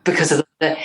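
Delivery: ordinary talk, nothing marked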